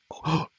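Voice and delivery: sudden loud, sharp intake of breath; real gasp of shock; startled gasp. Real, not acted.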